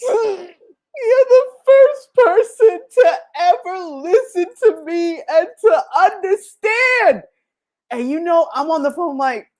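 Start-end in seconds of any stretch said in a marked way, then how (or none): 0.1-7.2 slow, high, crying voice; a crying customer, much slower and higher; tearful caller. Retold or imitated. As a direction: sob the words out as the moved customer. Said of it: "I brought her to tears?".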